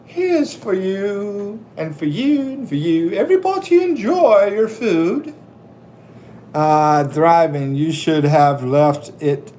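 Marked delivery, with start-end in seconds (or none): 0.1-5.3 a sweet granny handing out plates; old lady voice. Retold or imitated. Old lady offering food to everyone. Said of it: "acts like he's an old lady".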